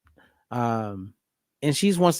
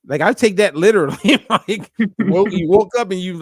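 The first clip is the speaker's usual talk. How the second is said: talking through real laughter, higher and louder; laughing; 4 semitones higher, 6 dB louder than usual. Real, not acted. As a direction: laugh the words out. Laughing while retelling the call.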